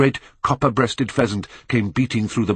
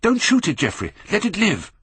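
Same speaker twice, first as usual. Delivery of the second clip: cried out; 13 semitones higher than usual. A narrator's reading. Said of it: "he cried out at once".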